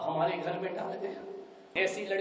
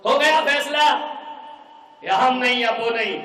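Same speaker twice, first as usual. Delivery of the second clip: angry ultimatum; 5 semitones higher, 9 dB louder than usual. Acted. Daughter declares her decision.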